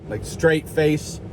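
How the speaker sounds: normal talking voice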